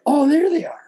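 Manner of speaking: high, delighted discovery; light up as you finally spot them; mock amazed oh there they are; sudden realization. Retold moment of discovery.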